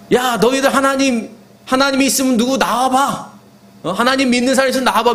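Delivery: high, challenging jeer; mocking taunt. Goliath daring God's people to step forward, mocking. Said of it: "막 이렇게 조롱하는 거예요".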